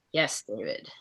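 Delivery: ordinary delivery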